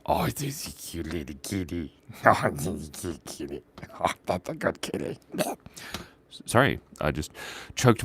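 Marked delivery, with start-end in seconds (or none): 0.0-5.2 put-on soft baby talk to a kitty; cutesy cooing. Artist fussing over the cute kitten just drawn, cooing. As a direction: coo quietly over an adorable pet.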